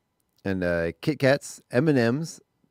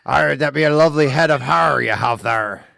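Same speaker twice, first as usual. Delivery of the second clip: louder, higher pirate voice; hammed-up pirate; 4 semitones higher, 7 dB louder than usual. Co-host jokes in pirate voice about a hairy head, hammed up. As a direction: play a swaggering pirate flattering someone.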